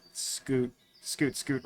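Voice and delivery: soft under-breath shooing; quiet murmur. Real, not acted. Shooing something off camera.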